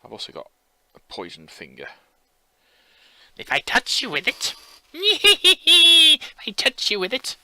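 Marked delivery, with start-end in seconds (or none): 3.4-4.5 high bleating goat voice. Acted. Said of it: "that's my goat impression". 4.9-7.3 very high, slow, giggling goat bleat. Acted. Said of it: "that's my goat impression".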